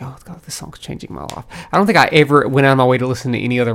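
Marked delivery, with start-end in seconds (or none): 0.0-1.5 breathless whispered awe; awed whisper. Retold or imitated. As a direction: whisper it like a revelation. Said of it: "I was like".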